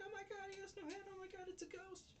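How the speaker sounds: very high panicky squeal; mock terrified. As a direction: shriek it like a girl who saw a ghost. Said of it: "She's like".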